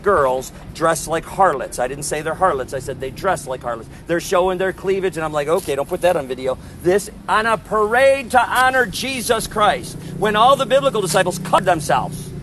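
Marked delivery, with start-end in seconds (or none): none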